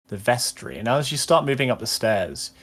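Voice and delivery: ordinary talk, nothing marked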